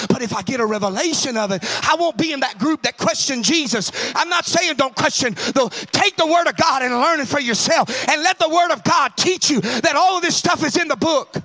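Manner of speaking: loud, rising preacher's cry; preaching at full force. Acted. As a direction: drive it home to the congregation.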